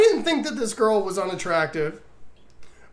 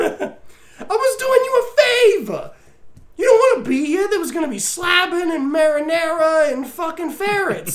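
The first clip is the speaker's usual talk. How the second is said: mock bro excuse; 10 semitones higher, 4 dB louder than usual. Voicing the character's excuse.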